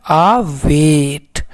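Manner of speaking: अवेट drawn out slowly, syllable by syllable; slow sounded-out word. Shown as a demonstration. Teacher to class, slowly sounding out अवेट. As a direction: stretch अवेट out for the students. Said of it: "तो इस तरह से अवेट पढ़ाया".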